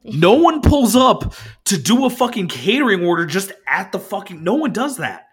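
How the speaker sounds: loud, high outraged rant; angry rant. Real, not acted. Ranting about the customer's audacity.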